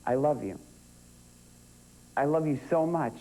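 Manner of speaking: lightly acted grieving son, long pauses; understated monologue at a war memorial; Ethan at memorial. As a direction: speak to a father you never met, holding back tears. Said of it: "a cutting from Fragments in which Ethan goes to visit his father's name".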